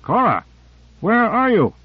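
restrained desperate call, rising high in pitch; high-pitched, contained shout. A narrator's reading. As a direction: call out searching, with mild desperation. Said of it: "He shouted desperately".

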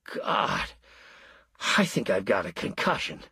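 half-whispered pained groan. A narrator's reading. Husband groaning from the fireplace after the blow, pained and dazed. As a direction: drag it out like your head is pounding. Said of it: "A horrible groan came from the fireplace".

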